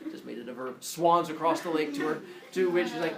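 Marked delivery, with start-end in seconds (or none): none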